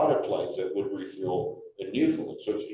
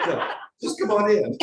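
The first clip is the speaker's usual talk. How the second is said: laughing Newfoundlander welcome; 10 semitones higher, 4 dB louder than usual. Retold or imitated. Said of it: "I'll be the Newfoundlander".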